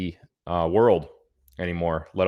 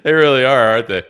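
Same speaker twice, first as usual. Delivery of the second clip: emphatic loud agreement; 4 semitones higher, 10 dB louder than usual. Real, not acted. Laughing agreement about the Yankees.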